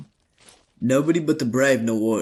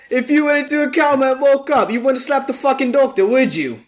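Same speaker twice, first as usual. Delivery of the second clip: raised voice, sharply challenging; heated retort; 9 semitones higher, 3 dB louder than usual. Acted. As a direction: argue back, much higher and forceful. Heated argument over the spirit.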